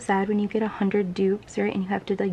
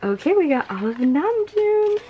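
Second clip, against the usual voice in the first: higher and louder, triumphant; excited; 8 semitones higher, 6 dB louder than usual. Real, not acted. Fan cheering at completing a card set. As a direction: celebrate the full set.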